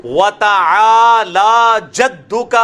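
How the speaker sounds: slow, loud chanted recitation; بلند آواز میں تلاوت; Quranic recitation. Shown as a demonstration. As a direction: recite it out to the class, long and ringing. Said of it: "یہ ہے وَتَعَا".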